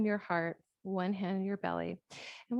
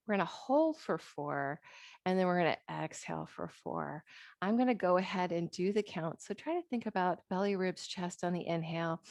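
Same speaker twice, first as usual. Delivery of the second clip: ordinary talk, nothing marked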